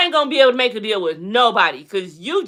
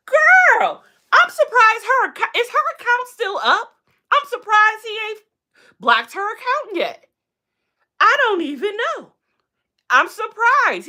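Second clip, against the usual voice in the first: high surprised; 12 semitones higher than usual. Real, not acted. Vlogger to viewers, voice pitched up in shocked disbelief. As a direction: squeal it like you can't believe it. Disbelief about her account.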